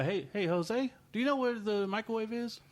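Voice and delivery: high-pitched mock call to a coworker; imitated calling out. Hotel guy asks Jose about the microwave, calling out high. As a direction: call over to a colleague, pitched well up.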